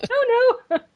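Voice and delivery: high, slow squeaky denial; a laughing imitation of a cowardly knight; mock Sir Robin. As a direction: squeal it out nervously while laughing. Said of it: "and he's like".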